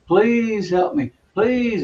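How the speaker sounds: high, drawn-out mock pleading; imitated crying plea. Retelling a crying patient in the next bed, imitated pleading. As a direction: stretch out "please" high, imitating a patient begging. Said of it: "A lady next to me was crying".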